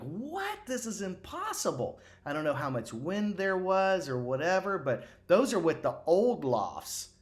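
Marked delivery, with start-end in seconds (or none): 0.0-2.0 retold scream. Golf coach recalling his astonishment at an old shot, mock screaming. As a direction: imitate an incredulous scream, pitched high without volume. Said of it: "I was screaming like".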